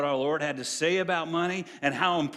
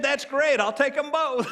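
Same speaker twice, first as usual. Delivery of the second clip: higher, pleased customer voice on the punchline; eager lady shopper; 7 semitones higher, 5 dB louder than usual. Acted. Preacher voicing the woman at the meat market, eager and pleased. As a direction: play the lady who is delighted to take both chickens.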